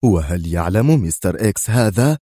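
usual speaking voice

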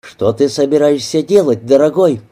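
plain reading voice

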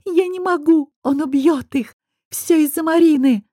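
high loud despairing scream; hysterical scream. A narrator's reading. Bound Nayra screams at Ayar in hysterics. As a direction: cry out in panic and blame.

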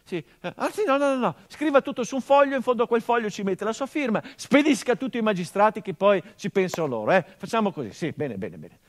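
a frightened officer babbling to get away; flustered panicked marshal. Acted. Marshal panicking in the dead man's study, loud flustered babble.

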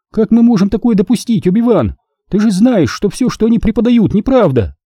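high, incredulous appeal; worked-up, high-pitched objection; indignant protest. A narrator's reading. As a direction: plead the point in disbelief. Siri's astonished protest goes on.

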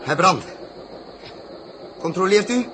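tense call to check the burn; loud calls. Acted. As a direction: push the words over the noise. Engine fires, captain calls checks.

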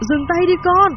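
high, desperate calling out; grandmother's wailing shout; anguished cry. Acted. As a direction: cry out to stop someone before they kill. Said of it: "Bỗng có tiếng kêu thảm thiết".

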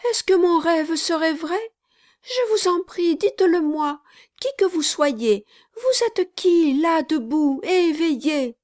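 put-on a child begging to know if the dream is true; high, anxious child's voice; small child.

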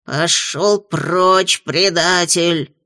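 slow jealous imp growl. Acted. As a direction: growl it out slowly. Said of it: "рыкнул он".

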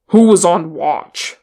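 loud, sharp demand; irritated, forceful challenge; snapped question. A narrator's reading. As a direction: snap it out like an angry leader. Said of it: "Thorin snapped".

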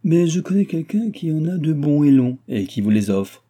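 coy, affected voice, high and loud; simpering. A narrator's reading. Viscount offering his arms to the old countess, simpering. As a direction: put on a mincing, ingratiating voice. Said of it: "fit du Barry en minaudant".

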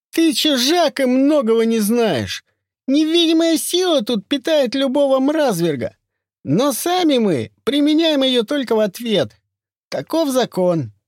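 high, loud lisping voice. Acted. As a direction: lisp every s like sh.

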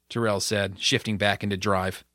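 ordinary delivery

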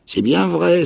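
high, loud eager cry; cried out. A narrator's reading. Castaway cries out to Jim, high and loud. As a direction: burst out with it. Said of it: "s'écria-t-il".

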